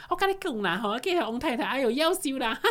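high, chattering neighborhood auntie voice; gossipy auntie. Pretend. Guest plays a village gossip auntie in the school play, high and shrill. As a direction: gossip like the nosy lady next door. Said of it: "隔壁阿桑啊".

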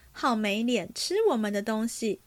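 plain reading voice

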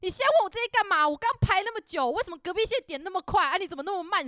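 put-on high, loud, fast old lady scolding; angry grandma yelling. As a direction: berate the cashier like a furious grandma. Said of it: "然后开始大骂我".

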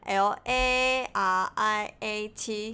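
slow letter-by-letter L A R I A T; spelling it out. Shown as a demonstration. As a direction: spell L A R I A T slowly and clearly. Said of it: "拼一次。". (慢慢逐个字母拼出 L A R I A T)

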